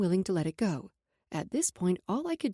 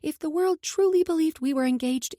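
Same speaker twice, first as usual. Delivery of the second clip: plain reading voice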